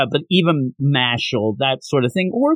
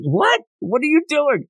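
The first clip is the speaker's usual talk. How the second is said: high-pitched squawk of disbelief; incredulous; 12 semitones higher than usual. Retold or imitated. Reviewer reacts to Reagan not knowing, incredulous. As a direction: leap up in pitch, baffled.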